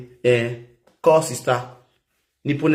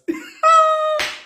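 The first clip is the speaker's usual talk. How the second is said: high laugh. Real, not acted.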